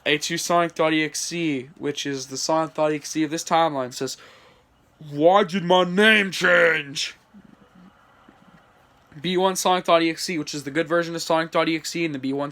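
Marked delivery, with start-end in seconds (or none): 5.1-7.2 slow, put-on villain voice; drawn-out indignant villain; villain outcry. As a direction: demand it slowly in a villain's voice. Villain character complains.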